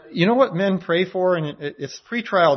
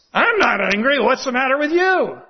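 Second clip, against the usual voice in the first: high, laughing snap back at the question; mock defensive denial; 9 semitones higher, 4 dB louder than usual. Imitating a friend who says he is not angry, high and laughing. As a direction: play a person insisting they aren't angry. Imitating someone denying anger.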